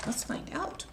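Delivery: soft unvoiced whisper. A narrator's reading. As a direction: lean in and whisper it. Reading to children, hushed.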